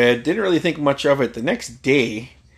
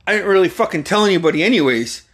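rushed, higher, loud nervous babble; scared friend; 6 semitones higher, 3 dB louder, faster than usual. Retold or imitated. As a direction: blurt it out in a scared hurry. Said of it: "this look of fear".

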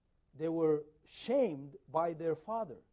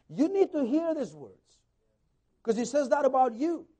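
ordinary talk

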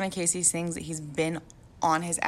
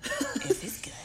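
whisper; 4 dB quieter, much slower than usual. Pretend. Mock ominous echo of if.

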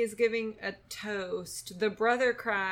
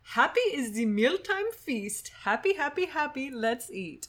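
mock German blessing; 5 semitones higher than usual. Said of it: "So what they say is".

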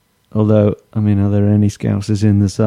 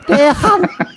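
very high mock Scouse; exaggerated high Scouse; Scouse accent. Shown as a demonstration. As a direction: squeal the surname in Scouse. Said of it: "for a Scouse accent".

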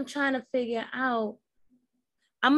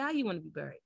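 quiet menace; 4 semitones lower, 9 dB quieter, much faster than usual. Retold or imitated. Quoting the cold killer.

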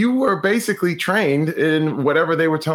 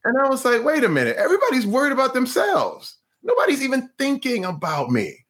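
dawning surprise; 11 semitones higher than usual. Retold or imitated. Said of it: "And it hit me".

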